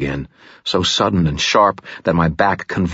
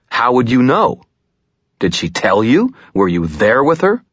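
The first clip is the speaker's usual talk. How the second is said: raised, loud, heated; angry; 7 semitones higher, 5 dB louder than usual. Acted. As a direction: demand it furiously. Furious questioning.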